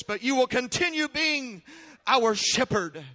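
loud, prayerful plea to the Lord; fervent prayer. Acted. Pastor praying aloud over his congregation, loud fervent prayer. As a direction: pray it out over the people.

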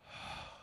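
long, soft exhale; weary sigh. Acted. Praying to God for help slowing down, weary sigh. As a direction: let out a soft, tired breath. Acts out pleading to slow down.

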